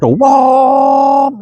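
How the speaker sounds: excited whoa. Retold or imitated. Kid in the back seat as uncle floors it, thrilled high shout. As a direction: yell it like the car just leapt. Car takes off fast.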